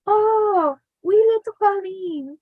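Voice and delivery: high, lilting mock Irish accent. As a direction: imitate grandpa's playful Irish accent. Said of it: "put on his Irish accent".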